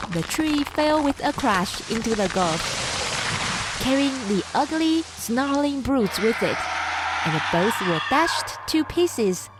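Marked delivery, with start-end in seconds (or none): none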